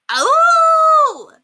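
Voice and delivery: very high, loud howl; child howling. A narrator's reading. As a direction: let out a wild howl. Alexander loses control, howling.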